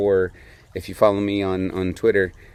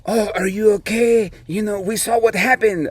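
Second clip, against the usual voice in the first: concerned French skier; 11 semitones higher, 4 dB louder than usual. Retold or imitated. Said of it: "one of them was French".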